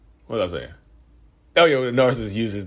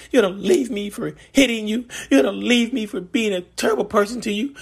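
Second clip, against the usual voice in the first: whiny abandonment wail; 13 semitones higher than usual. Retold or imitated. Manipulative partner accusing you of abandoning them, high whining wail. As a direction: pile on the guilt, climbing into a wail. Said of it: "You see how the voice changes, the inflections".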